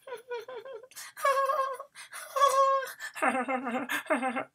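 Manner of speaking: put-on high, far-off giggling of many children; class giggling. Classmates hearing the name, giggling high. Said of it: "everybody giggled".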